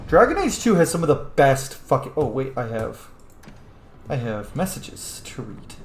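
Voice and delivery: normal talking voice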